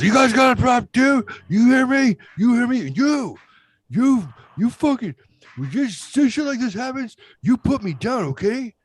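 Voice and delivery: high, loud imitation of a furious dad; drunken angry yelling. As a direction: yell like a drunk, pissed-off father at his sons. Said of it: "and he's yelling at me".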